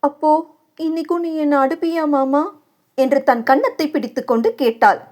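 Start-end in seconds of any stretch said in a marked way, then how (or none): 0.0-2.4 frightened wife asking her husband; fearful plea. A narrator's reading. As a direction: ask him, scared of being hit. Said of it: "அச்சமும் கலக்கமும்".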